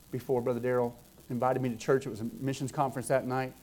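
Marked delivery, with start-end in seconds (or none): none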